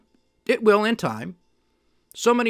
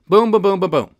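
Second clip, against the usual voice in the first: loud, punchy run of booms; imitated booms; 2 semitones higher, 6 dB louder than usual. Equity holders getting wiped out, imitated rapid loud booms. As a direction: knock them down one after another, boom by boom.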